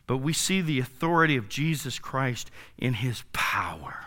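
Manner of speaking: hushed emphatic whisper. Real, not acted. Preacher stressing Jesus' power, whispered. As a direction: breathe it to the congregation.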